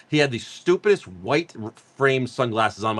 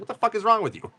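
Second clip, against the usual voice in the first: higher mock exasperated outburst; 9 semitones higher than usual. Auditor rounding on offended people, higher mock outburst. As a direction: toss it off as the auditor snapping back. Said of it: "He's like".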